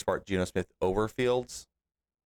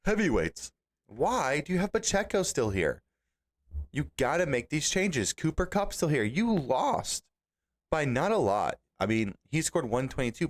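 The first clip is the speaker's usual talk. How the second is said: exasperated; 6 semitones higher than usual. Real, not acted. Scolding a friend's fantasy roster.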